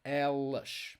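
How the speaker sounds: "Elas" with the first E opened up. Shown as a demonstration. Teacher repeating the feminine form, open E in "Elas". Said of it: "Opens up the sound here".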